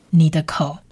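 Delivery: ordinary delivery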